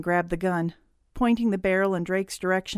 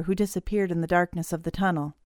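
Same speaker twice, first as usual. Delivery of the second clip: ordinary delivery, nothing marked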